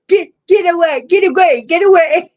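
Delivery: high, loud imitated get away; mock shooing yell. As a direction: shoo someone off, hammed up. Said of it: "And he's like".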